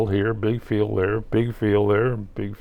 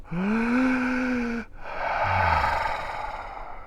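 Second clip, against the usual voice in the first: long high breathy sigh; 14 semitones higher, 6 dB quieter than usual. Shown as a demonstration. Taking a breath as told.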